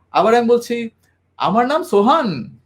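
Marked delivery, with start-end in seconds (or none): 1.4-2.6 "আমার নাম সোহান" with "Sohan" lifted high, as if asking a question; rising end on a statement. Shown as a demonstration. Trainer showing a statement that wrongly ends like a question, lifting "Sohan". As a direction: make "Sohan" sound like a question. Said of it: "আমি যখন এইভাবে বলছি".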